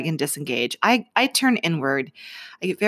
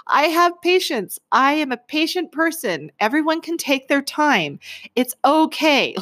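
high, hammed-up patience mantra; insistent self-coaching; 9 semitones higher than usual. Driver coaching herself through road rage, insistently patient. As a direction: talk yourself down in a pitched-up mock calm.

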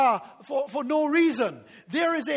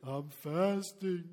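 low, slow, softer mock-pious voice; hammed-up long-suffering faster; droopy pious faster; 8 semitones lower, 7 dB quieter, much slower than usual. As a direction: sag into a sorry, self-pitying drawl.

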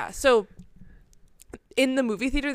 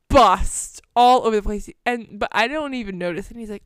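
ordinary delivery

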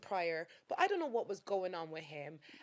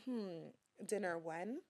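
slow, softer seductive voice; sexy voice; 2 semitones lower, 11 dB quieter, much slower than usual. Shown as a demonstration. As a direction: draw it out, soft and sexy. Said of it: "Give a sexy voice".

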